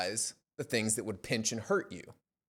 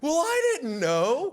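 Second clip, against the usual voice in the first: high, slow, louder defensive whine; mock-innocent squeak; sheepish friend; 7 dB louder, slower than usual. Friend excuses himself to the coach, whiny.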